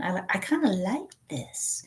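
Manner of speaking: whispered confession. Retold or imitated. Retold quiet confession.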